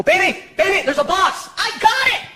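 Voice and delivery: alarmed mock shout; urgent warning. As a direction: warn her fast before she hits it.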